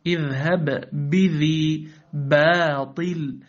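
slow "اذهب بذي باطل", each b firmly closed; strong ba. Shown as a demonstration. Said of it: "احرص على الشدة". (إظهار شدة الباء في "اذهب بذي باطل")

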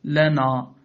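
"لنا" with a dark, backed final aa; wrongly heavy ending. Shown as a demonstration. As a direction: thicken the end of "لنا". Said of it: "ولا تقل". (تفخيم ألف "لنا")

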